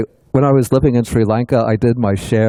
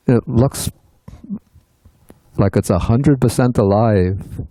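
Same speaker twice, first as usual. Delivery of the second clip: ordinary talk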